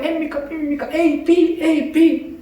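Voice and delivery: high, hushed repeated plea; childlike pestering. Retold or imitated. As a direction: nag the Lord like a child. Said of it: "어린아이처럼 그냥 계속 기도하는 거예요". (아이처럼 높고 작게 반복하는 기도)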